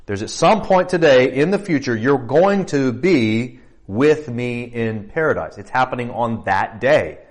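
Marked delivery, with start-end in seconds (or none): none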